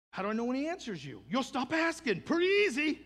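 high, loud, climbing to a shout; emphatic preaching. Real, not acted. Preacher answering church's question on prayer, loud emphatic preaching.

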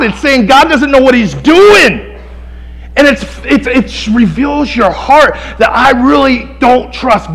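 high, loud pulpit preaching; impassioned preaching. Acted. Preacher hammering Scripture home to the congregation, full-force preaching. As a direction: preach it hard, punching key words.

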